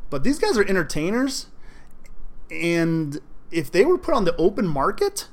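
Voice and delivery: usual speaking voice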